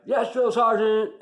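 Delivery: slow, high, loud shouted reply; recruit response. Retold or imitated. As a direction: bark it back at the drill sergeant. Recruit answering the drill sergeant.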